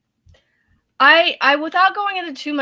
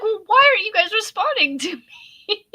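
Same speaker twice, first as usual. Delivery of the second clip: confused; 9 semitones higher, 4 dB louder than usual. Retold or imitated. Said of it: "I'm like".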